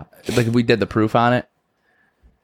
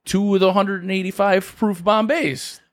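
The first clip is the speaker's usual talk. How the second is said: put-on drunk driver; 8 semitones higher than usual. Drunk driver answering officer.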